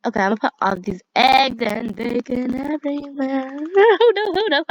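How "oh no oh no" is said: loud, high laughing oh no; laughing panic. Real, not acted. Gamer laughing as the prank unfolds, loud and high. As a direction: squeal it through laughter.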